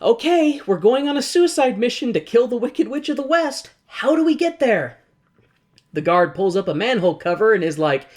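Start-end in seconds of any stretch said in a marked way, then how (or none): 0.0-5.0 high cheerful mission talk; chipper heroes. Retold or imitated. Group asking the door guard the way, high and chirpy. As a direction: sound perky about a suicide mission. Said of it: "are like".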